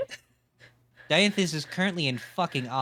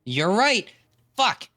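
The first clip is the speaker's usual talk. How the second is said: frustrated realization; 8 semitones higher, 4 dB louder, slower than usual. Real, not acted. GM realizes zombie dies.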